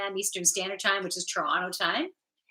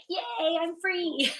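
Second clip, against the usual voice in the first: voicing the emoji; 9 semitones higher, much slower than usual. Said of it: "the hearts here and here".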